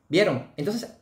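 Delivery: normal talking voice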